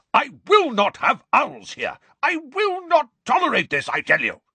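very high, strained roar; furious roar. A narrator's reading. Uncle banning owls from his house, furious roar. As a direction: roar it, hammering out the refusal. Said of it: "roared Uncle Vernon".

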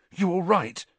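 worried. A narrator's reading. Ron worried.

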